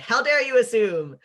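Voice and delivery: loud, high mock outrage; playfully brash, affronted cry; brassy indignation. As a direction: throw it out like a bold challenge, hammed-up.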